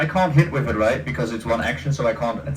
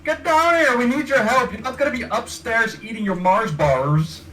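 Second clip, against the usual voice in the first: yelling up the stairs; calling upstairs; 7 semitones higher than usual. Real, not acted. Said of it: "Yell a bit more at the one upstairs".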